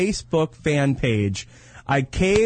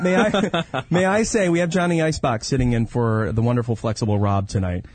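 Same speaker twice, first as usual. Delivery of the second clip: ordinary delivery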